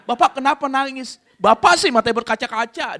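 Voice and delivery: high, loud retold exchange; mock accusing. Two men asking each other why they cry, loud mock accusing.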